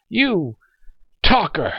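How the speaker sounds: high, angry snap; snapped. Acted. Old man rebukes the young soldier, snapping. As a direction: spit the insult out sharply. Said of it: "he snapped".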